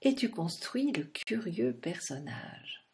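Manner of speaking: put-on low, hushed instruction to the child; quiet invitation to build; soft invitation. Shown as a demonstration. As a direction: say it softly as a gentle prompt. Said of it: "Prends ton souffle".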